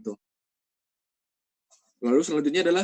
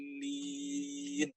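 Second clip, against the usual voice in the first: six-beat madd; much slower than usual. Shown as a demonstration. Teacher reciting with a long final vowel. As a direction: stretch waladdallin for six beats. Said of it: "enam harokat panjangnya".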